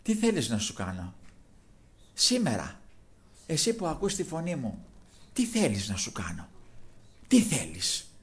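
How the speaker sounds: very low, soft, measured question; gentle asking. Retold or imitated. Jesus asking the blind man what he wants, gentle asking. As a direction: drop right down and ask tenderly, with pauses.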